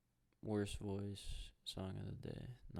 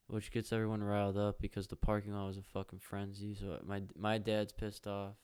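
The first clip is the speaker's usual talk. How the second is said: ordinary delivery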